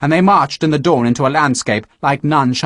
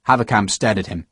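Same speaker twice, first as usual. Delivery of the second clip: ordinary delivery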